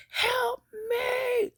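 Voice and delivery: very high, shrill-free cry for help, drawn out; yelling for help. Retold or imitated. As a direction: scream it out like you're calling across the quad. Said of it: "just started yelling".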